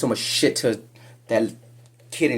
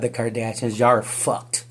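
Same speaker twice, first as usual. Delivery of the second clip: ordinary talk, nothing marked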